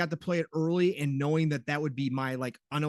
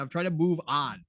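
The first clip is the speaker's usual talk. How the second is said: normal talking voice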